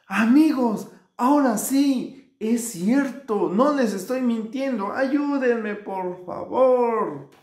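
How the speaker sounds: high, slow, drawn-out plea; desperate shouting. A narrator's reading. Shepherd boy begging his friends for help, high pleading shout. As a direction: call for help, stretching the words. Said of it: "comenzó a gritarle a sus amigos".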